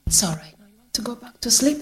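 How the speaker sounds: soft, hushed reassurance; soothing carer. Retold or imitated. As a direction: gently coax someone back to sleep, quietly. Carer soothing resident to sleep.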